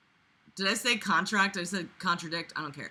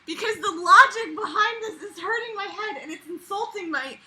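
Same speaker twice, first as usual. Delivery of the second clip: high, laughing disbelief; losing it at the logic; a touch of hysteria; laughing exasperation; 8 semitones higher than usual. Real, not acted. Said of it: "I'm going insane".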